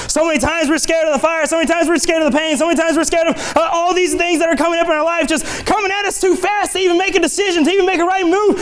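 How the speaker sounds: driving repetition, pitch climbing steadily; impassioned sermon build-up; fervent preaching. Real, not acted. Preaches at full force.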